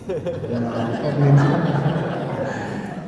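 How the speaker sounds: drawn-out, laughing reply; sheepish laughing no. Retold or imitated. Embarrassed answer, laughing.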